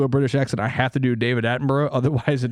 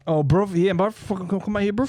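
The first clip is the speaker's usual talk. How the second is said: higher-pitched British bruv caricature; mock bruv accent; 5 semitones higher than usual. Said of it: "bruv accent".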